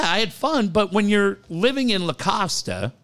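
ordinary delivery

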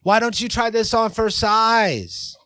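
every word of "Why don't you try this on for size" pushed high and heavy; overemphasised. Shown as a demonstration. Host overacting his partner's bully catchphrase, too much emphasis. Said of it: "you're putting too much emphasis on this".

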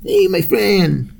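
high, slow mock Spanish accent. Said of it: "That's a stereotypical voice".

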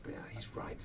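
unvoiced whispered agreement; whispered thought. Thinking back on David's advice, a soft whisper. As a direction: whisper it to yourself. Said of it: "And I just thought".

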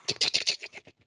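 quick voiceless ticking noises; whispery tick-tick sound effect; imitated knife-game tapping. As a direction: click out the taps of a knife game.

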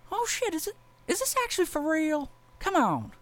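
retelling, doing the cop in a high voice; high-pitched cop voice. Retold or imitated. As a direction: play the baffled cop in a high squeaky voice.

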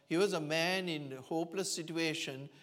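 ordinary talk, nothing marked

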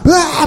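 high-pitched mock scream of frustration; frustrated scream. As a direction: let out an exasperated high yelp, acted out. Acting out fly-plagued wait. (짜증 섞인 비명 흉내)

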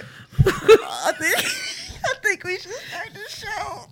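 breathless laughter through words; giggling while talking; laughing. Real, not acted. Laughing fit.

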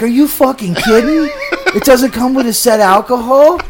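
laughing disbelief. Retold or imitated. Retold disbelief at bartender.

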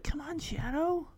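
soft pleading under the breath; will him on quietly; hushed urging. Retold or imitated. Peter waits for Shadow, soft urging.

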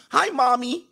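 put-on high-pitched childish greeting; mock mommy's boy. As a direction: greet her like a little kid, way up high. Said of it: "Do you call her mommy".

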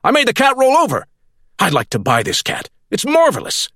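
over the moon about a cat trick; thrilled Burnett. A narrator's reading.

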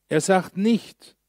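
nicht stretched out with a rising tune; slow down and lift on nicht; slow emphatic nicht. Shown as a demonstration. Preacher setting up a contrast, slow and pointed. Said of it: "slowed contrast phrase".

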